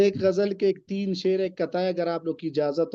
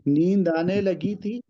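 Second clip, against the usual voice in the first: poem recitation. Acted. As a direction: recite the couplet with weight. Recites a ghazal couplet.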